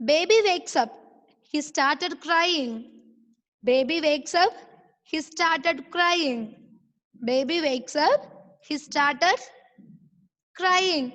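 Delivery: slow, drawn-out read-aloud for learners; model reading. Shown as a demonstration. Teacher reading the story to online pupils, slow model reading. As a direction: read each word slowly with long stretched vowels.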